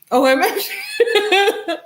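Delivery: high, breaking into laughter; can barely speak for laughing; cracking up mid-word. Real, not acted. Bursts laughing.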